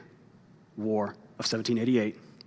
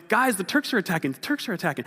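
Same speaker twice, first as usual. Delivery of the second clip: mock panicked yell; 7 semitones higher, 4 dB louder than usual.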